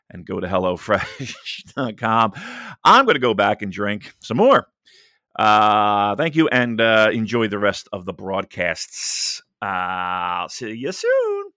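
ordinary talk

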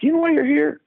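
high mock-stern doctor question; teasing doctor. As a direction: poke fun at the patient, pitched high. Specialist teasing smart-aleck patient.

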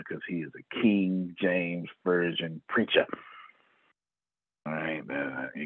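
ordinary talk

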